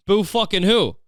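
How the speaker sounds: high, sneering boo-hoo; mock their tears with a jeer. Real, not acted. Mocking a sob story.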